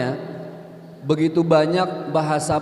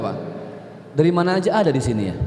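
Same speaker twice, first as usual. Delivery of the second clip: ordinary delivery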